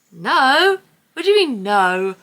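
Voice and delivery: high, loud disbelieving no; can't believe it, loud and high; incredulous no. Retold or imitated. Disbelief at security.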